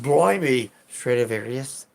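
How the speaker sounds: loud hammed-up British accent on Blimey; a put-on British accent, overplayed; mock British accent. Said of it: "I broke out of character".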